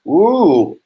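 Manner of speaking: high, loud happy ooh; light up at a fellow South Carolinian; delighted ooh. Real, not acted. Host greets a viewer from South Carolina, delighted ooh.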